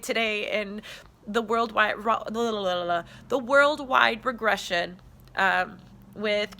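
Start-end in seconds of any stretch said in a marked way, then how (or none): none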